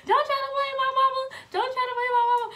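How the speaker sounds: high, loud angry yell. Retold or imitated. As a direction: yell it at your daughter. Angry mom retold.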